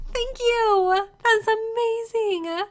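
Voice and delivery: ordinary talk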